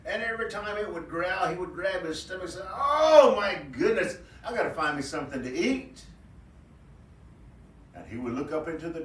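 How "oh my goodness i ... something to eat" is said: high, excited rabbit voice, stretched oh; a hungry rabbit clutching his growling belly; hungry trickster rabbit. Acted. Hungry trickster rabbit cries out to himself, high and excited.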